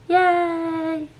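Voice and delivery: high, happy little cheer; excited cheer. Real, not acted. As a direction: squeal it with delight. Celebrating finished filming.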